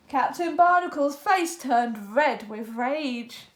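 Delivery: ordinary delivery, nothing marked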